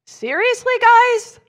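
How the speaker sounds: Jesus finding his friends asleep again; exasperated outburst. Retold or imitated. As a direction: burst out in disbelief.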